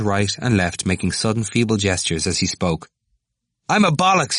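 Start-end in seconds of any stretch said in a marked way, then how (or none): 3.7-4.4 high, loud outburst of despair. A narrator's reading. Temple owning up to the students, in worked-up despair. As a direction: blurt out the confession. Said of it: "shaking his head in despair".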